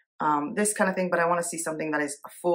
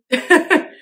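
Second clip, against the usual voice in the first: short high burst of laughter; quick squeal of a laugh; 11 semitones higher, 8 dB louder than usual. Real, not acted. Laughs at missing squares.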